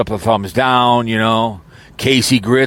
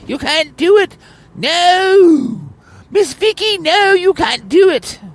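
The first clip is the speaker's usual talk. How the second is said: very high falsetto, pleading and possessive; ranting jealous woman; slower than usual. Pretend. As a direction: shriek it like a jilted rival at the altar. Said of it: "she'll start ranting and raving".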